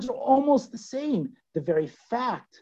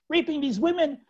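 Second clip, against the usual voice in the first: normal talking voice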